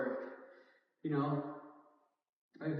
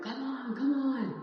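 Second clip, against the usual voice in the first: mock yelling; 9 semitones higher, slower than usual. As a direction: imitate a trainer yelling at a client. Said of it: "they're yelling at the client".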